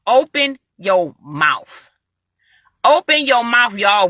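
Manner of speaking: very slow, word-by-word exhortation; slow, deliberate command; emphatic urging. Real, not acted. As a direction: stretch out each word to drive it home. Urging listeners to speak prayers aloud.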